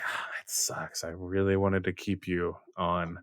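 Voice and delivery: soft, low sighing regret; sighing whisper. A narrator's reading. Zoe sighing to Deuce she wanted to keep. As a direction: breathe it out disappointed. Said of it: "Zoe sighs".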